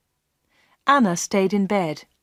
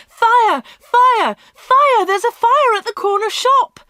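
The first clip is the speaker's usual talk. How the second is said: high, loud alarm shouts; shouting; 7 dB louder than usual. A narrator's reading. Villagers running in the street to warn everyone, shouting. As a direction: raise the alarm down the street at full pitch. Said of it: "People were running and shouting".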